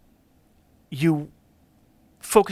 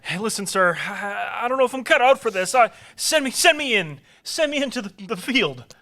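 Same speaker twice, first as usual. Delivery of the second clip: pleading; 7 semitones higher than usual. Acted. Nervous soldier begging.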